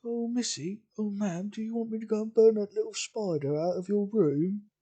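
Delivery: high, loud, mocking servility; very sarcastic. Belle mocks Rachel's fear of a spider, very sarcastic. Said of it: "in a very sarcastic tone".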